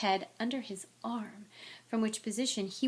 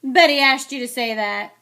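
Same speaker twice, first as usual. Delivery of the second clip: loud, jeering accusation; yell; 3 semitones higher, 14 dB louder than usual. A narrator's reading. Sir Patrick's head heckling Harry, yelling. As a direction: shout it down scornfully. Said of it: "yelled Sir Patrick's head".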